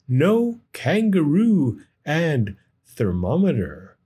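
ordinary delivery, nothing marked

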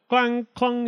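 high, ringing bell clangs; imitated bell. A narrator's reading. Coachman rings his bell, high clanging imitation. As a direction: ring it out like a handbell.

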